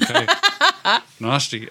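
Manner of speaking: amused disgust; laughing. Real, not acted. Laughing reaction to gory curse.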